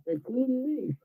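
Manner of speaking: laughing. Real, not acted. Laughing at own joke.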